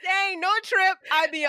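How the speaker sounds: high, loud protest; loud denial. Real, not acted. Host denying the trips to co-host, loud protest. Loudly denying co-host's claim.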